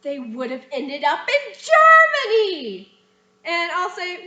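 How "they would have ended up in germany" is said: exaggerated stage line. Shown as a demonstration. Demoing a student's line.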